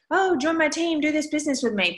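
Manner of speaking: high, mock sugary recruiter pitch; mock salesy pitch. Mocking spam recruiters to her team, in a high sugary pitch. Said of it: "message everybody ... and say".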